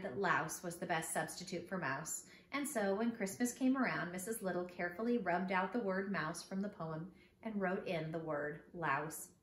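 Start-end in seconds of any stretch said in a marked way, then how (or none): none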